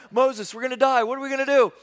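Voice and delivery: high, loud mock panic; panicked slaves. Slaves cry to Moses at the sea, mock panic. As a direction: voice the slaves crying out in alarm.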